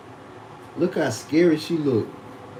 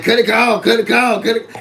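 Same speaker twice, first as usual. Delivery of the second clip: higher and louder, nagging to cut the engine; mock frantic gas-saver; 7 semitones higher, 7 dB louder than usual. Mocking people worried about gas, high loud nag. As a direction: mimic penny-pinchers yelling to shut it off. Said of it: "They be like".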